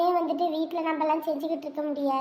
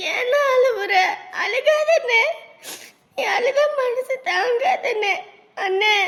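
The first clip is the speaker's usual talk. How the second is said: crying plea; 5 semitones higher, slower than usual. Acted. Sibling begs crying brother.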